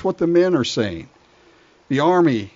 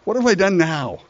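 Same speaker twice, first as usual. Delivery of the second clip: higher, whiny protest; exasperated little brother; 6 semitones higher than usual. Acted. Younger brother protesting to his big brother, whiny. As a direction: sigh it out like a put-upon kid. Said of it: "And David said".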